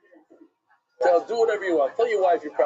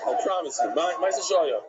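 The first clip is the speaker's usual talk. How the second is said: ordinary delivery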